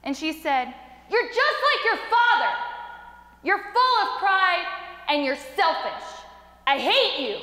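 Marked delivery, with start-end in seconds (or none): 1.1-7.4 mother's angry yell, high at the start. Retold or imitated. Angry mother home from work to her son, yelling. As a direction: lash out, retold at raised pitch. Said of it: "she started yelling at me".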